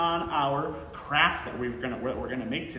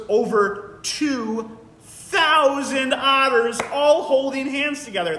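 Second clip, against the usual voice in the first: excited wonder; 7 semitones higher, 6 dB louder, slower than usual. Real, not acted. Marvelling at huge otter raft.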